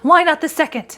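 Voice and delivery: put-on high, loud and urgent, an old woman gripped by a wild hope; feverish old woman. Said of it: "said the old woman feverishly".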